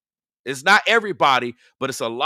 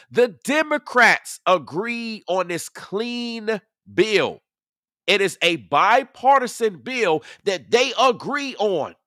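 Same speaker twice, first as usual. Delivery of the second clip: raised pitch, stretched-out words; slow, pointed repetition; 5 semitones higher, slower than usual. Real, not acted. Streamer repeating it for slow people in the chat, slow and emphatic. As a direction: spell it out as if for slow listeners. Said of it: "Let me repeat this for the slow people".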